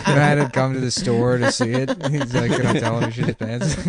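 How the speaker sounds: cracking up through the words; laughing while talking. Real, not acted. Teller recalling friend's visit to see, laughing through it. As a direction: tell it through real laughter.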